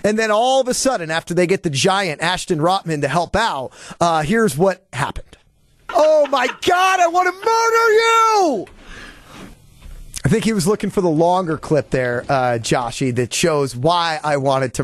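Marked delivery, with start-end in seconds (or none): 5.9-8.7 furious shout. Real, not acted. Host yells at producers through a dead line, furious. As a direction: yell it in pure exasperation, voice cracking high.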